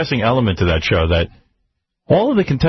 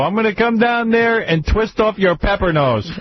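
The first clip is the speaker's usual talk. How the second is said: much higher, slow mock-menacing threat; prank caller threat; 9 semitones higher than usual. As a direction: drag the taunt out like a phone bully. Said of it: "the guy would go".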